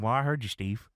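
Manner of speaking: lightly voiced low, subdued reply; a hint of the commentator. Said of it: "And J R said".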